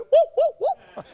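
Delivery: high, light whoops, half laughing; joyful whooping. Acted. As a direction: let out little mock whoops of joy. Mimes a small outburst of joy.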